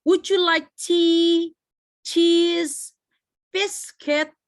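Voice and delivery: slow, loud list with tea, cheese, biscuit each held high; separate, stretched items at a high pitch; rising list intonation. Shown as a demonstration. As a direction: keep tea, cheese and biscuit up, options still open. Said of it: "intonasinya akan terus naik ke atas".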